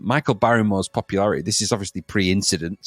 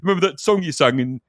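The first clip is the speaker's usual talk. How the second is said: higher, insistent fan's badgering; mock pestering; 4 semitones higher than usual. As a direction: egg him on relentlessly. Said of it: "went up to Michael Barrymore and went".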